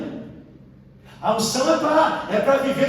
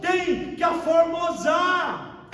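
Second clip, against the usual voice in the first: preaching shout; 5 semitones higher, much slower than usual. Real, not acted. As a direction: drag each word out in a shout. Preacher proclaiming to congregation.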